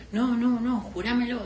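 high-pitched disbelieving demand; mock insistence. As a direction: imitate someone insisting on an oath. Acting man demanding an oath.

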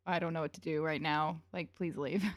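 low, soft, awkward muttered plea; uncomfortable. Retold or imitated. Marco squirming at his dad's date questions, uncomfortable. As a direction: shrink down small and quiet, wishing out of it. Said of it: "so uncomfortable".

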